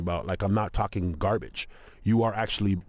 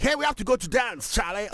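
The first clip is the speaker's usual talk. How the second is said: put-on African accent, much higher, sing-song; 11 semitones higher than usual. Comic imitating African guys saying Charlie, African accent. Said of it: "Africans always say Charlie".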